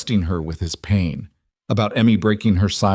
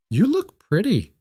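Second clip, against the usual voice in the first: put-on high, slow little-girl voice; little child; 12 semitones higher than usual. A narrator's reading. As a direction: admire her slowly in a small high voice. Small child admires the bride.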